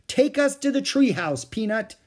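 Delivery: put-on high, loud girlish order; eager command. Annie telling Peanut to lead them, eager command.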